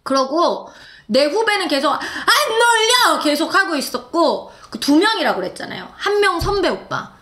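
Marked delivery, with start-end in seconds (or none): 1.9-3.2 high, drawn-out yell; stretched mock concert shout; imitated fan cheer. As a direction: holler it like a devoted fan. Imitates junior's continual fan chant.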